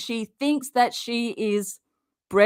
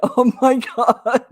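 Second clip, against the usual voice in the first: real laughter breaking through the words; giggling in disbelief at her makeup; laughing; 2 semitones higher, 4 dB louder than usual. Real, not acted. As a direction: laugh while getting it out. Laughing at the palette.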